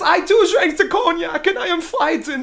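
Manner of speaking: put-on high, loud, overwrought French accent; Yvette, awful French accent. Imitating the maid afraid to be alone, hammed-up French accent. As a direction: plead frantically in a bad French accent. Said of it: "in this awful French accent".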